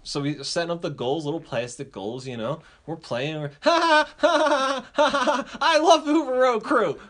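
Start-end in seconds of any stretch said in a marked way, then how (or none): 3.6-7.0 high, loud kid whooping with mock laughter; gleeful cheering. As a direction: yell out sheer joy mid-game. Kid acted whooping while playing.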